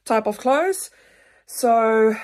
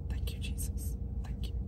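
whispered thanks in prayer, after a sigh; barely audible, real heartfelt whisper; emotional whisper; 14 dB quieter than usual. Real, not acted. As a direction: whisper it, overcome with feeling. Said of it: "I'm getting emotional right now".

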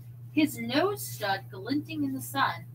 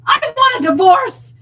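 much higher, very loud wail; 20 dB louder than usual. A narrator's reading. Wife wailing at husband in class, loud wail. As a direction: wail it out in anguish. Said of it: "Nancy wailed".

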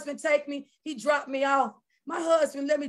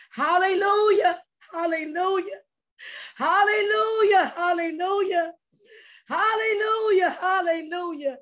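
tearful praise shout; 5 semitones higher than usual. Real, not acted. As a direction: cry out hallelujah over and over. Reliving worship inside the machine.

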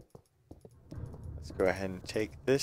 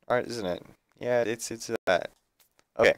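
ordinary delivery

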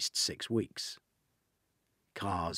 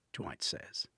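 ordinary delivery, nothing marked

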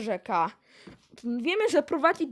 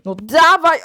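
impatient shout; 14 dB louder than usual. Acted. Asks to be slapped on the back.

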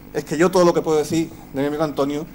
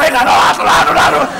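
much higher, much louder booming croon; hammed-up bellowing lullaby; 6 semitones higher, 12 dB louder than usual. Antonio singing roughly to his crying baby, booming croon. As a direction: blast it like a clumsy loud lullaby.

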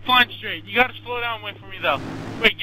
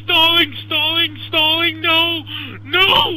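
panicked alarm; 6 semitones higher, 5 dB louder, slower than usual. Real, not acted. Plane stalling mid-flight.

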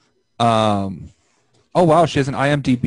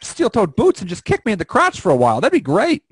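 mock chipper; 7 semitones higher, 4 dB louder than usual. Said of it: "joins Brandon's chipper bit".

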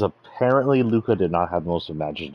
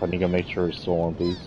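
usual speaking voice

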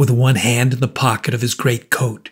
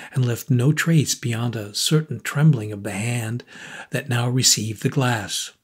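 ordinary delivery, nothing marked

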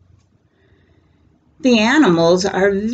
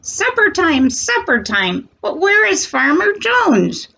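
higher, sing-song duck calling for supper; 10 semitones higher than usual. Acted. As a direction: ask like a hungry little duck. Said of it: "says the ducks".

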